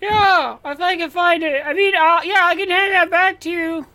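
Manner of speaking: squirm and stall like a guilty borrower; flustered neighbor. Acted. Neighbor Jason stalls over the borrowed mower, high and flustered.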